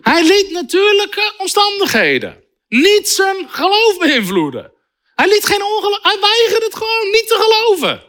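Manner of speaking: high, fired-up preacher voice; fervent preaching. Acted. Preacher to congregation, fired-up fervent preaching. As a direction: preach it with rising fervor. Preacher driving home point.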